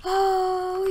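high, loud "oh"; excited cry. Real, not acted. Player thrilled mid-game, excited cry. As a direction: let it burst out high and loud.

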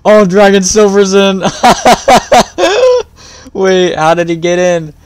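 high, loud delighted squeal; excited surprise. Real, not acted. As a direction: shout it out in happy disbelief. Excited surprise at stream joiner.

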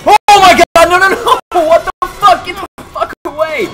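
high, very loud shrieks of panic; terrified screaming. Real, not acted. Player fighting off the psycho doctor, terrified screaming. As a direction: scream at it to get back.

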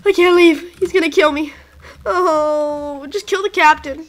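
normal talking voice

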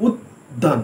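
উদ্যান said as উদ, a brief stop, then দান; doubled d, small pause. Shown as a demonstration. Teacher shows how উদ্যান is pronounced with a doubled d. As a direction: hold the d in উদ্যান before দান. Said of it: "একটু উদ বলে থেমে গিয়ে তখন আমরা দান বলছি".

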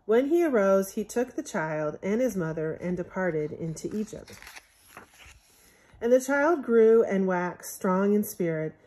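usual speaking voice

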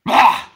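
sudden loud startled cry; startled yelp. Real, not acted. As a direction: jump out of your skin with a yelp.

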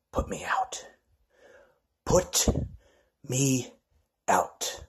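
soft breathy whisper of a dare; whispered taunt. Acted. Wrestler daring his rival in a whisper.

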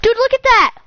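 high excited exclamation; excited calling out. Real, not acted. Gamer showing a friend the stream, excited. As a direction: point it out with a high burst of excitement.

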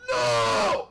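real, sudden cry of protest; frustrated shout. Real, not acted. Game goes wrong, shouts no.

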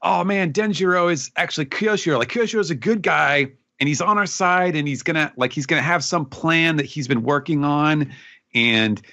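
high-pitched imitated enthusiasm; excited fans. Imitating readers after the last chapter, excited relief. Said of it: "everybody's like".

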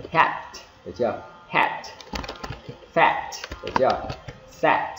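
"hat" and "fat" with a crisp T burst; short words ending in an audible, breath-released T; released final T. Shown as a demonstration. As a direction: pop the T at the end of "hat", "fat". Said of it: "em đều phát âm chi ra".

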